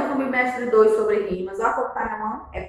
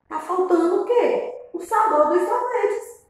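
high playful suspense; 8 semitones higher than usual. Acted. Teacher building suspense for children.

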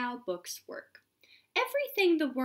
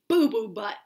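silly name; 2 semitones lower, 7 dB louder, faster than usual. Pretend. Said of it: "a hippo named".

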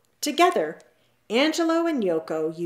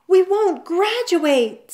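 high, loud, drawn-out wail; panicked; 11 semitones higher, 8 dB louder, slower than usual. A narrator's reading. Olive hiding in the closet, panicked loud wail. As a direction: wail it slowly in alarm. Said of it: "panicked and were hiding".

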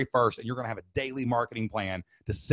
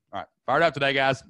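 ordinary delivery

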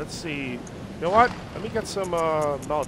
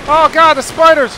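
high panicked yell at spiders; startled shout; 10 semitones higher, 14 dB louder than usual. Real, not acted. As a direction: yelp in sudden fright. Spiders ambush the player.

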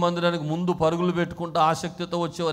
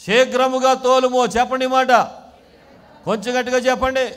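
high, raised preacher's voice; loud rallying call; 8 semitones higher, 9 dB louder than usual. Real, not acted. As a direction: get the congregation shouting it back. Rouses congregation to repeat louder.

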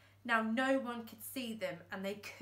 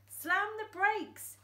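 much higher, slow, loud; shouted; 11 semitones higher, 5 dB louder, slower than usual. Acted. Thumbs panicking at the tree, shouted. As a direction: yell the command. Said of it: "shouted Thumbs".

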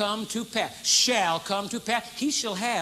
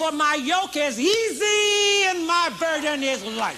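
slow, loud, high climax on "for my yoke is easy"; slow, high sermon climax; preaching shout; 7 semitones higher, 7 dB louder, slower than usual. Acted. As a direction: build to a shout of promise.

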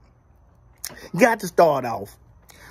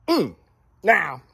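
ordinary talk, nothing marked

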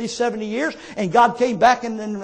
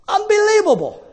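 amazed exclamation; 9 semitones higher than usual. Real, not acted. Preacher marvelling at the rooster lesson, amazed exclamation. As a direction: stretch it out high, then fall.

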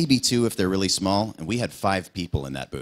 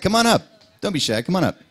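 high, louder inviting call; high, louder welcome to a shy fan; calling them up; 13 semitones higher, 5 dB louder than usual. Real, not acted. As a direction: wave them up to the mic with your voice.

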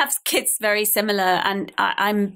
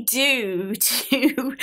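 laughing; 3 semitones higher than usual. Real, not acted. Laughing while speaking.

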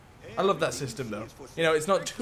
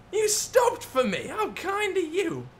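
mock-sweet thanks; 13 semitones higher, slower than usual. Truck stops for him.

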